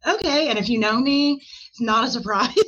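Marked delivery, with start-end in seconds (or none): 0.1-0.5 sudden high, louder okay; bright quick yes to a whim; eager okay. Retold or imitated. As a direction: jump at the idea. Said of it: "I was like".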